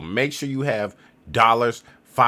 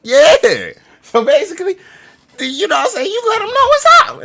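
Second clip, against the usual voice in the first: much higher and louder hype; hyped up; 12 dB louder than usual. Real, not acted. Hyping viewers on using the translator, loud and excited. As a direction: amp it up, let 'em know.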